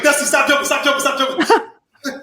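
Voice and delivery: high, loud mock panic; imitated frantic pleading. Straining lifter begs Dusty to stop jumping, frantic.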